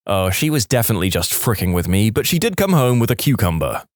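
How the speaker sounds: plain reading voice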